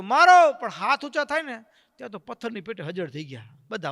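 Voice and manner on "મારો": loud, high shout to attack; shouted order. Retold or imitated. Villager shouts the attack signal at Maharaj, loud and high. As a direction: bellow the signal to strike. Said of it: "એક જણાએ સાદ કર્યો".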